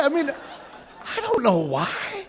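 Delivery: ordinary talk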